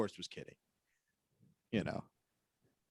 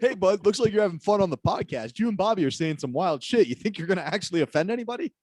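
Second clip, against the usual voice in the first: mock interviewer; 6 semitones higher, 4 dB louder than usual. Voicing a lawyer's email.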